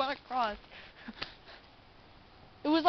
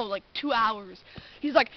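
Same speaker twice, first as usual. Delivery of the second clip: usual speaking voice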